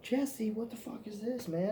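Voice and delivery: hammed-up shocked protest; incredulous. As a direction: pitch up in outraged disbelief at your buddy. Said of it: "I'm like".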